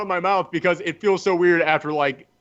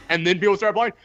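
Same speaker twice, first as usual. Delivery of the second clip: normal talking voice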